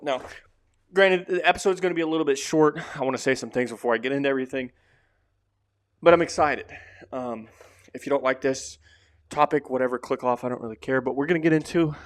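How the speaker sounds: usual speaking voice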